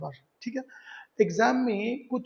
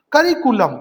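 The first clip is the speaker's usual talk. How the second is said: Curriculum said loud and higher with a falling tune; headword called out; 5 semitones higher, 8 dB louder than usual. Shown as a demonstration. Teacher calls out curriculum loudly for students. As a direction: announce curriculum sharply to the class. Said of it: "teacher announces headword".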